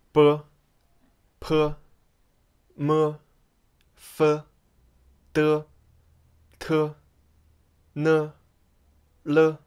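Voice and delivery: B P M F D T N L as isolated syllables on a level tone; each Pinyin initial voiced alone, held evenly; Pinyin pronunciation demo. Shown as a demonstration. Teacher shows learners how to pronounce initials, Pinyin demo. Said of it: "how to pronounce them".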